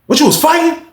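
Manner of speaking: high, loud mock interrogation; questioning officer. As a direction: imitate a suspicious guard's sharp question.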